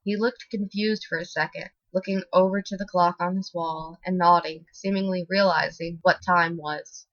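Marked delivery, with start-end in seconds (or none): none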